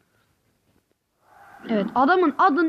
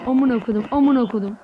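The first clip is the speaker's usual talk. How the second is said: ordinary delivery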